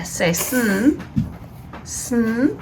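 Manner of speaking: "Sn" nasal blend, no vowel; sounding out words. Shown as a demonstration. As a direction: hum the "Sn" with no I. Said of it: "it's nasally".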